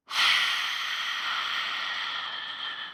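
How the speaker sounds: long, breathy exhale; deep sigh. Shown as a demonstration. Guide showing listeners how to exhale, deep audible sigh. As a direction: let a deep breath all the way out. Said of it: "Then with a deep sigh out".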